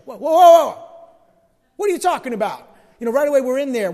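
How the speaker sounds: barge in loudly to stop them talking; hammed-up interruption over a friend; mock interrupting outburst.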